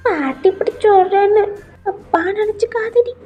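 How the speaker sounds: high, pleading granny voice; acted weepy old woman; tearful grandmother. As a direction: plead as if holding back tears. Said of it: "எதுக்கு அழுதுட்டு இருக்கீங்க".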